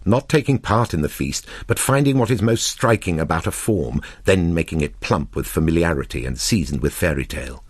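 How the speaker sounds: ordinary delivery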